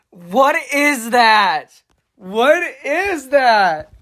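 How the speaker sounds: slow, loud, incredulous exclamation; loud disbelief. Real, not acted. Reacting to a nerd emoji on screen, loud disbelief. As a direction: draw it out loudly, utterly baffled.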